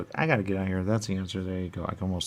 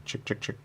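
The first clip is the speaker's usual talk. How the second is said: whispered calling a chicken; a soft chick-chick call; 4 dB quieter than usual. Pretend.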